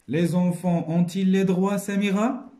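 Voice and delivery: reading dialogue. Shown as a demonstration. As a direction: read the question out to the class. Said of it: "teacher reads dialogue aloud". (lecture à voix haute du dialogue)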